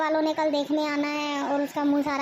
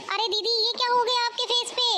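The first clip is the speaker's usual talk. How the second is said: much higher gasping question; alarmed concern; 8 semitones higher than usual. Acted. Daughter-in-law sees the sister-in-law's face, shocked and high. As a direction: rush in shocked at her face.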